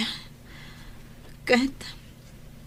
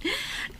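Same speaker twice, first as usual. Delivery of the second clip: laugh; 12 semitones higher, 10 dB quieter than usual. Acted. Laughs at husband's sweet words.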